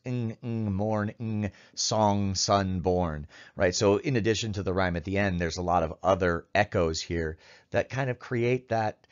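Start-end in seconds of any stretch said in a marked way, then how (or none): 0.1-3.3 ng clipped hard like a bell, Ng, ng, morn, ng; sharp ng sounds. Shown as a demonstration. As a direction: chime out each ng sharply, Ng, ng, song, sun, born. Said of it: "the sharp repetitions of the ng sounds".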